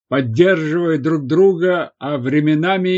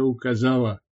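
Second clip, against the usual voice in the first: usual speaking voice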